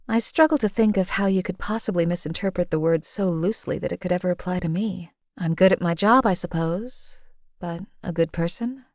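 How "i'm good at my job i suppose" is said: usual speaking voice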